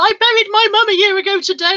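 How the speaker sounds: mock-distressed, pitch jumping up; distressed outburst. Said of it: "getting quite sort of distressed".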